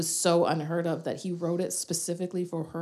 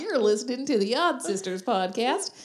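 loud, high, hammed-up cowboy twang; cowboy accent; 5 semitones higher, 7 dB louder, slower than usual. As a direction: greet the listeners like a rodeo host. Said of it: "in that accent".

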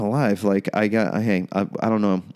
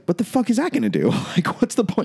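incredulous; 10 semitones higher than usual. Retold or imitated. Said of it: "And I was like".

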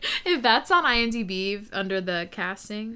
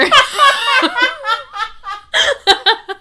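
long loud cackle; loud laugh; 11 dB louder than usual. Real, not acted. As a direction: burst out laughing. Laughing at drunk accusation.

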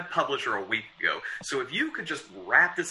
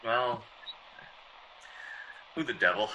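usual speaking voice

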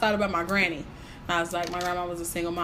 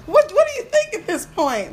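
high, loud incredulous question; exasperated; 12 semitones higher, 8 dB louder than usual. Real, not acted. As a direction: demand it in disbelief. Exasperated aside to listeners.

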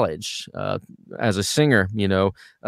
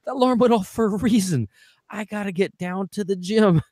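sudden alarm; 11 semitones higher than usual. Retold or imitated. Waking late, suddenly realizing the gym is waiting, in a jolted voice. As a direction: snap awake with a start.

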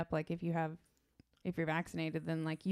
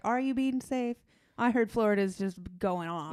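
raised, louder questioning of relatives; mock challenge; 4 semitones higher, 5 dB louder than usual. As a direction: push back at the family in a higher voice. Retold challenge to the Florida family.

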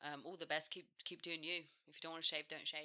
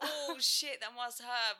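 laughing; 6 semitones higher, 5 dB louder, slower than usual. Retold or imitated. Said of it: "It was like".

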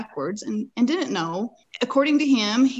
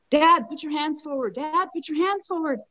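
higher, urgent re-enacted call; retold yell; 7 semitones higher than usual. Rider calling to her father-in-law near a canal ledge, retold yell. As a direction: call out a warning, holding back panic. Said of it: "I yelled out to my father-in-law".

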